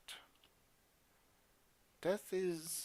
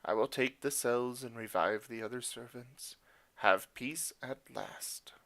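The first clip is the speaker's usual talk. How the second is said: solemn hero. Acted. Voicing the hero's reply.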